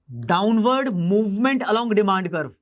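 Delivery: Downward movement along demand curve said very slowly, word by word; slow dictation. Shown as a demonstration. Teacher repeating the term for students to note, very slow.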